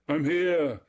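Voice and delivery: high, loud echoing cry. A narrator's reading. Echo catching Narcissus's words, crying out eagerly. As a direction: throw the call back, pitched high and loud. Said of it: "she cried".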